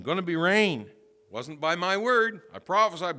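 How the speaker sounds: high, forceful prophetic announcement; loud proclamation. Acted. Prophet announcing the coming rain, loud proclamation.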